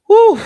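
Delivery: loud, high-pitched "whew"; high whoop. Real, not acted. Vlogger reacting to Kenya on aid, high whoop. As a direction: let out a sudden high hoot.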